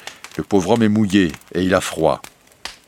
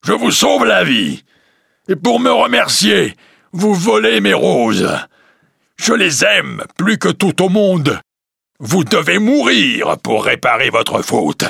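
much higher, loud, strained monster voice; angry beast; 12 semitones higher, 5 dB louder than usual. Acted. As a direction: rage at the thief like a wounded monster. The beast rages at merchant. (la bête furieuse gronde le marchand)

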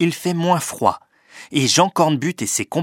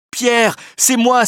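high, slow, excited call; exclaimed cry; 10 semitones higher, 4 dB louder, slower than usual. A narrator's reading. Penellan hails his old companion Pierre, high cry. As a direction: call out eagerly, drawing the words out. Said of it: "s'écrie Penellan".

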